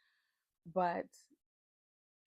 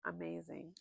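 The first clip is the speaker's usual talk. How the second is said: ordinary talk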